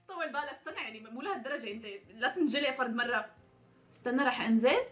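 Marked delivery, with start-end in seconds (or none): none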